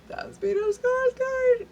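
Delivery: high, slow starstruck squeal of recognition. Retold or imitated. Fan spots the actor, high slow starstruck squeal. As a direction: gush his name like a giddy fan. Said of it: "would be like".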